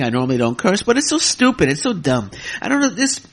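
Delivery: ordinary delivery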